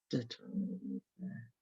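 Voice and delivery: echoed murmur. Shown as a demonstration. Quiet echo of phrase.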